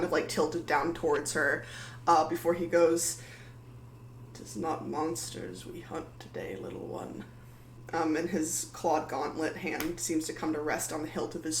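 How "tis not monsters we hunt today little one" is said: unvoiced, cold paladin whisper down at a small woman; menacing whisper. Paladin looms over Maddie and whispers menacingly. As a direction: breathe it out like a quiet threat.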